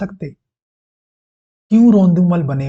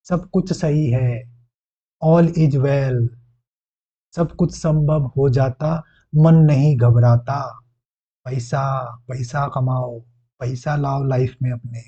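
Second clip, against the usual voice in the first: slow, drawn-out mantra; spoken affirmation; slower than usual. Shown as a demonstration. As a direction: lead a self-affirmation aloud, unhurried. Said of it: "लेकिन आपको मुंह से बोलना है".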